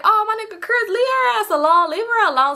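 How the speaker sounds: hammed-up high taunt; mock heckle. Group heckling in the story.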